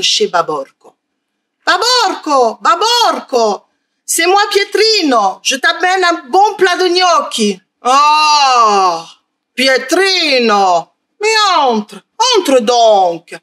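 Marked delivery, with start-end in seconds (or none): 1.7-7.6 put-on calling child voice. Pietrino calls at the ogre's door. 7.8-13.3 ogre voice. Acted. Ogre welcomes the boy.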